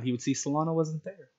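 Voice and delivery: ordinary talk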